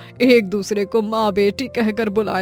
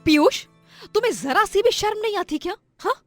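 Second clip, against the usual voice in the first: higher-pitched, hurt wife's reproach; indignant scolding; 8 semitones higher, 6 dB louder than usual. Acted. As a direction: scold the husband, upset and accusing. Wife confronts thieving drunk husband.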